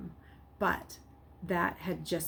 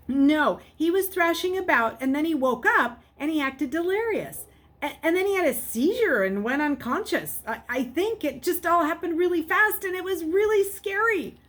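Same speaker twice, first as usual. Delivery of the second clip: high, loud, breathless rush of panic; panicked boy explaining to the nurse; frantic and scared; 10 semitones higher, 8 dB louder than usual. Acted. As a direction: blurt it all out to sound convincing. Luke frantic, rushing his story.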